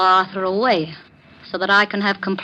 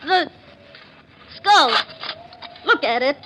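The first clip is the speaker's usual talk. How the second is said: sudden fright; 6 semitones higher than usual. Acted. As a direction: jolt up in fear at what you see. Sees the skull move.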